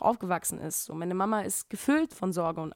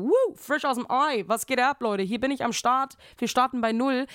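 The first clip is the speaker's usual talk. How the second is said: higher, louder hyped-up newborn greeting; cocky newborn; 4 semitones higher, 4 dB louder than usual. Pretend. Baby fresh from the womb greeting everyone, hyped. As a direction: burst out like a party host. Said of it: "rauskommen und sagen".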